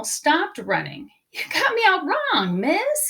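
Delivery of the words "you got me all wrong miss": sly wolf. Acted. Wolf voiced sweetly.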